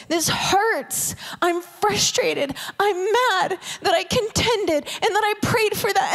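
mock frustrated prayer; upset complaint. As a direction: let out frustration to God. Acting an honest complaint to God.

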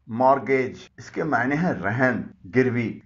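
usual speaking voice

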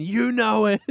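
high, put-on teasing voice; smug prank caller. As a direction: taunt him in a silly high voice. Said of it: "I just kept saying".

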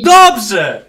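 loud shouted praise; shouting. Real, not acted. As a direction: call it out loud. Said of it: "A dlaczego krzyczymy?".

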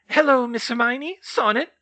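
high, loud elf voice; high-pitched, courteous elf; house elf greeting. A narrator's reading. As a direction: greet them as a small elf.

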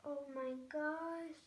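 high, drawn-out gushing; adoring coo. Real, not acted. Vlogger admiring the doll's cute skin, adoring. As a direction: coo over it as at a newborn.

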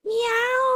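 high squeaky mock meow, mimicking a cat; baby-talk meow for the kitten; playful cat meow. Lin meows for the cat.

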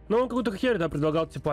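normal talking voice

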